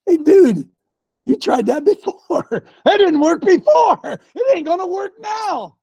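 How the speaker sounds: high, loud taunt at an enemy; defiant shout. Acted. Preacher facing the devil over a relapse, defiant shout. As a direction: shout the attacker down.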